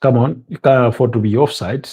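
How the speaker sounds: normal talking voice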